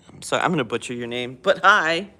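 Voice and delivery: ordinary talk, nothing marked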